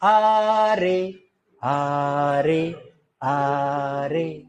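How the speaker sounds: drawn-out आ रे, dying away; slow stretched calls. Shown as a demonstration. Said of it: "ऐसे धीरे जाएंगे".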